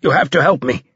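wounded soldier's urgent appeal; short of air. A narrator's reading. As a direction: plead while fighting for air. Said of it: "pausing to gulp air".

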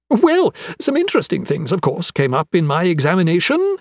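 high, lively character voice; eccentric, eager anatomist. Acted. Anatomist turning to his examination findings, eagerly animated. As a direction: pick up the report with bright-eyed relish.

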